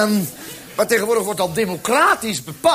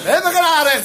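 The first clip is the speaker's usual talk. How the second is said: high, mock child's cry; called-out reply; 6 semitones higher, 4 dB louder than usual. Little sister contradicting grandpa, calling out. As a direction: shout back in a raised pitch. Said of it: "riep mijn zusje".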